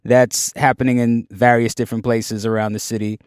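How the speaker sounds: ordinary talk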